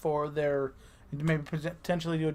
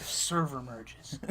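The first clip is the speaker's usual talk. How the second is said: sultry; 5 semitones lower, 8 dB quieter, much slower than usual. Pretend. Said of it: "That is a sexy series of words".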